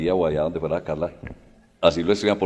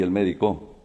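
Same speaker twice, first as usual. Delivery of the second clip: "médico" said as me-di-CÓ; stress on last syllable; 3 dB quieter than usual. Shown as a demonstration. Teacher contrasting three words, final stress on "médico". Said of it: "no es lo mismo decir".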